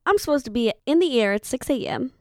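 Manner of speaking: ordinary talk, nothing marked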